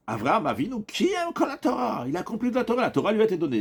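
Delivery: high-pitched acted proclamation; emphatic declaration. Teacher voices Rav's statement to students, emphatic declaration. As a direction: declare it high, stretching the key words.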